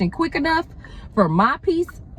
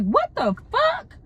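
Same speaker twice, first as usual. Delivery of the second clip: shocked reaction; slower than usual. Retold or imitated. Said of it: "that was my first initial reaction".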